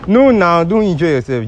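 high, loud plea to stop; loud, insistent objection; urgent protest. Real, not acted. Stopping the upset woman.

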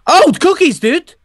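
high, loud delighted exclamation; thrilled at finding cookies; excited. Real, not acted. Ghost spots cookies at the breakfast table, delighted.

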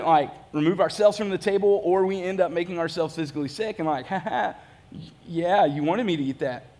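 ordinary delivery

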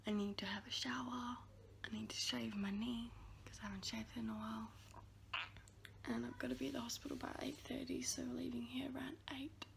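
hushed early-morning whisper. Real, not acted. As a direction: whisper so nobody wakes up.

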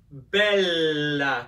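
"bella" slow, with a long held L; drawn-out Italian pronunciation. Shown as a demonstration. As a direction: linger on the double L in "bella". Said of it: "The first word is".

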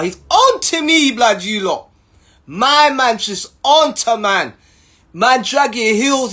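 mock stern prison officer telling him off; a hint of a strict officer. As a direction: lightly play the officer scolding. Officer scolding him, retold.

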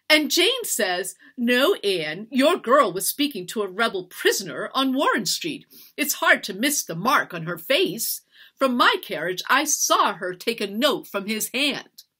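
indignant accusation. A narrator's reading. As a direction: relay the accusation with outrage. Mistress relays gossip's accusation angrily.

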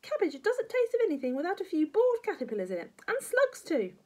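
high, loud granny relish; batty grandma. Acted. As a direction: push the horrid food on the boy.

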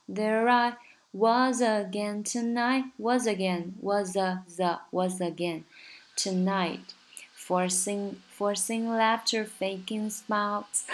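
"There I" run together as one sound, "was again" linked; linking demo. Shown as a demonstration. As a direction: glide the words into each other. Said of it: "连读就很好找啦，there I".

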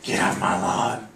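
put-on loud crabby old-timer yell; grumpy old man. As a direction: yell like a cranky neighbour. Grumpy old neighbour bit.

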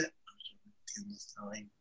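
a shaken whisper to yourself; whispered disbelief. Retold or imitated. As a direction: whisper it, stunned and lost. Stunned inner thought, retold.

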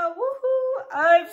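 high, loud happy whoop; excited whoop. Real, not acted. Fan cheering before reading the letters, excited whoop.

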